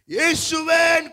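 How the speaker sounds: high, loud, drawn-out cry; shouted call. Acted. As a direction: blind man calling Jesus over the crowd, shouting. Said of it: "சத்தம் போட்டு கூப்பிடுறான்". (உரக்கக் கூவி அழைத்தல்)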